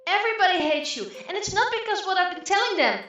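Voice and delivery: quarreling sister. Acted. Sushi retorts to Ao, high and loud. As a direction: hit back hard.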